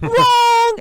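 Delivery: loud, high-pitched gotcha shout; imitated triumphant buzzer. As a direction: shout it like a game-show wrong answer. Mom's triumphant hang-up line.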